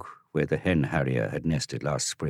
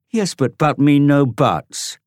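put-on high, slow, louder boyish bluster; stammering boy; 10 semitones higher, 9 dB louder, slower than usual. Boys arguing, high slow loud interruption. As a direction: cut the protest off. Dorian stammers, Tom cuts.

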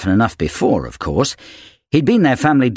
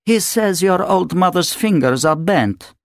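put-on higher accented voice of a haughty wife; Natasha, dismissive; 7 semitones higher than usual. A narrator's reading. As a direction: explain scornfully to the husband. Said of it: "Natasha translates for husband".

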